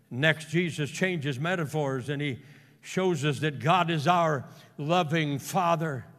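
normal talking voice

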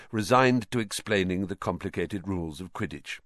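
ordinary delivery, nothing marked

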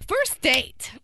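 high, loud burst of excitement; excited yell. Real, not acted. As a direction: shout it out with glee. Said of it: "You yelled".

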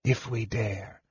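very soft, faint reply with long pause; hushed, breath-thin answer. A narrator's reading. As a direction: barely let the words out. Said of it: "faintly, he replied".

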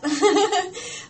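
high, bright burst of laughter; happy giggle. Real, not acted. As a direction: giggle with pride. Proud of invite.

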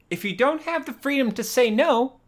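high-pitched, mounting mock-earnest plea; impassioned lecture. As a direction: make the point with rising indignation. In-character speech arguing over payment.

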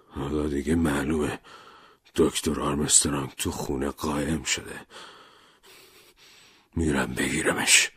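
grimly, throat tight; hoarse muffled voice. Acted. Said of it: "با صدایی خفه و گرفته". (لومبارد با صدای خفه و گرفته)

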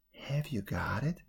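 soft hushed question; whisper. A narrator's reading. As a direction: ask it softly, hushed. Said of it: "asked the ogre in a whisper".

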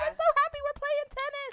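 high, loud sing-song glee; mock cheerful idol. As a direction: parody the carefree tennis concept. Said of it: "we're like".

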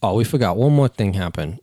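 normal talking voice

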